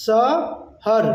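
"শহর" drawn out as "sa - har"; long "sa", pause, then "har"; broken into syllables. Shown as a demonstration. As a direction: sound out "শহর" in two Nepali syllables. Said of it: "নেপালিতে বলবো".

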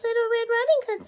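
high put-on girlish voice; wolf pretending to be a sweet little girl; wolf faking a little girl. A narrator's reading. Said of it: "trying to sound like a little girl".

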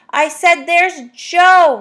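roaring. Acted. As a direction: bellow it with impatience. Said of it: "roared What's-his-name".

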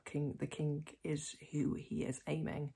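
ordinary delivery

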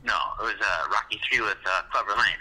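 ordinary delivery

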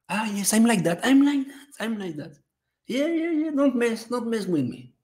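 high sing-song playful bragging; mock boasting. Host teasing chat over his rule, high mock-tough boast. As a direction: play at being tough in a high voice.

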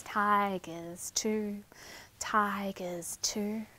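soft, low whisper before the roar; whispered suspense. Shown as a demonstration. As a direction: hush them before the pounce.

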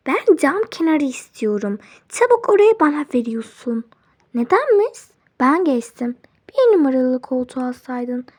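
loud, demanding seat squabble; bossy quarrel. Acted. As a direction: snap back and forth over the window seat. Passengers fight over window seat. (yüksek sesli koltuk kavgası)

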